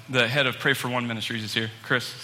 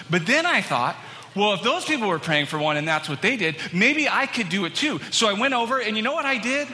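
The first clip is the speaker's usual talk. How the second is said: imitating an eager newcomer, climbing high in pitch; bounding excitement; 5 semitones higher than usual. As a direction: gush it out, building toward the big reveal. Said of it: "Comes bounding into me".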